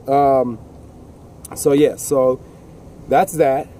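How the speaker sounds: ordinary talk, nothing marked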